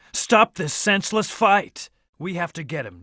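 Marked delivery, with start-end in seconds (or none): 0.1-1.9 high, drawn-out urgent command; raised-pitch demand to an opponent; urgent plea. Acted. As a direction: call out to stop the fight. Urging the Doctor to stop fighting.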